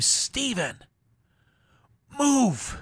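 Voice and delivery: high-pitched retold yell at a child; retold scream. Father screaming at his toddler blocking the TV, retold yell. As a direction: call out sharply high, re-enacting a scream. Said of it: "I screamed".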